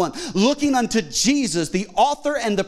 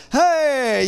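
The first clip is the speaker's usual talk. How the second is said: higher, drawn-out mock greeting; feigned recognition; 7 semitones higher, 4 dB louder than usual. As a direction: cover up not knowing their name. Faking recognition of an acquaintance.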